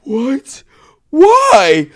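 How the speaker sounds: sudden high, loud shock; high shocked squeak; shocked outburst. Real, not acted. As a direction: yelp in disbelief. Told he needs a procedure.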